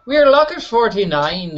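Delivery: put-on a poor, lightly done Scottish accent; failed Scottish accent, a bit high; attempted Scottish accent. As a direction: try a Scottish accent and miss. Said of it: "That's not a Scottish accent in the slightest".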